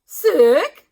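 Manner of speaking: loud rising question; eager sick. A narrator's reading. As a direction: pounce on the word. Doctor perking up.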